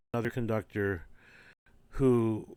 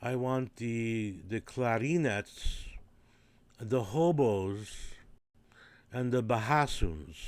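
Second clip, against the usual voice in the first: put-on slow, careful Hungarian-accented English; lightly done Hungarian accent; Hungarian conductor accent; slower than usual. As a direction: mimic the conductor, drawing out each word. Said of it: "he would say things like".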